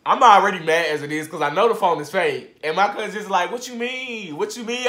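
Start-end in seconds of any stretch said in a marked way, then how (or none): 3.5-4.9 higher, dopey mimic of the cousin; mocking cousin. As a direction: parrot her slow question. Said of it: "this slow-ass question".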